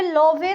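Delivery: लोवे as "lo-vay", final e sounded; mispronounced love. Shown as a demonstration. Teacher showing what not to say, लोवे as "lo-vay". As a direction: read लोवे as two syllables with long o. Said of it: "तो नहीं बोलेंगे".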